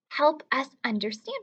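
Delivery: normal talking voice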